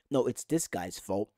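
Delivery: mock one fan blaming, kept low; low-voiced blamer. Fan imitating fans picking someone to blame, low accusation.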